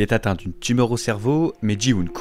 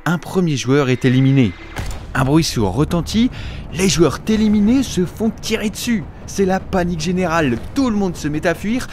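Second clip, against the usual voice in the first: excited narration; 7 semitones higher than usual. A narrator's reading. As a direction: build the panic as you tell it. Narrating the massacre scene. (narration de plus en plus excitée)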